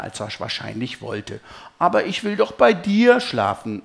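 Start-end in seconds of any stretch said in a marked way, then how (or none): 1.8-3.8 bittendes Kind, höher und lauter; traurig. A narrator's reading. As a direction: bettle mit nur einem Hauch Traurigkeit. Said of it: "sage ich traurig". (nicht allzu trauriges Kinderbetteln)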